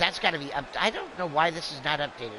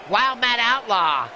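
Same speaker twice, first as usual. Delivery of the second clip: slow, louder arena announcer call; ring announcer call; 3 semitones higher, 4 dB louder, slower than usual. Acted. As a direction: draw the name out for the crowd. Announcing wrestler entrance.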